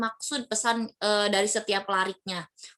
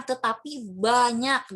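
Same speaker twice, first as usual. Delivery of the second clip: "banyak" drawn out slowly; slow and weighty on "banyak"; stress on banyak; much slower than usual. Shown as a demonstration. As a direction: stretch "banyak" for emphasis. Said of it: "kalian ingin menekankan".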